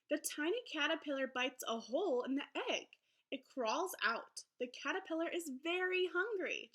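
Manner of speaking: lively, sing-song picture book read-aloud; reading to children. Acted. Teacher reading a butterfly book to her class, lively storybook voice. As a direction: read it brightly to young listeners.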